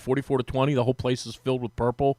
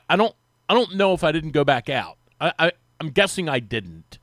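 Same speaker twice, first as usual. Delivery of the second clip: ordinary talk, nothing marked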